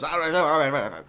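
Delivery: loud, hammed-up string of concessions; hasty mock backpedal. He has over-explained the joke to her, loud mock backpedal. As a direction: blurt it loudly, imitating the character backing off. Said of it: "Which is why he then goes".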